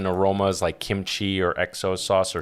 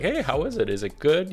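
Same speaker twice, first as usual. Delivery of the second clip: eager husband asking; 6 semitones higher than usual. Retold or imitated. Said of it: "I'll go to my wife and be like".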